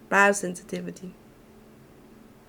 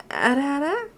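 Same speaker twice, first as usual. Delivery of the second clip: ara ara tease; 6 semitones higher than usual. Shown as a demonstration. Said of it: "You sound like you are from here".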